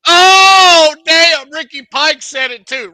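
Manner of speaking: high, loud burst of delighted surprise; loud shout of delight; excited shout. Real, not acted. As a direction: yell it out, thrilled.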